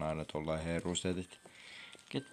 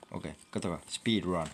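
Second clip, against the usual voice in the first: normal talking voice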